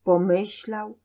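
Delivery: usual speaking voice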